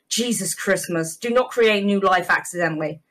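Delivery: usual speaking voice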